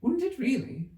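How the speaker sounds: put-on girlish voice leaping high in surprise; great surprise. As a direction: gasp it out, truly amazed. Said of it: "in a tone of great surprise".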